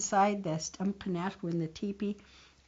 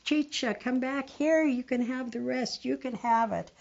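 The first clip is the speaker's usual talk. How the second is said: crying plea; 6 semitones higher than usual. Acted. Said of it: "he was crying and crying".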